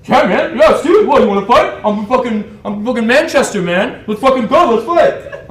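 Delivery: very loud, high-pitched hothead; imitated belligerent shouting. British guy boasting he's Manchester, yelling for a fight. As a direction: roar like a Brit spoiling for a fight.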